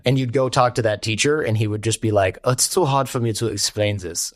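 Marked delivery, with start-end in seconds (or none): 2.4-4.3 put-on German accent. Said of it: "with a really strong accent".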